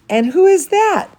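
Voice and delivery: excited surprise. Real, not acted. Storyteller to children, spotting someone in the picture, excitedly.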